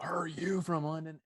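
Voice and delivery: hushed, slow demo of the drill instructor's tone; showing the listener how the drill instructor sounded. Shown as a demonstration. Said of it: "you gotta get the tone right of the voice".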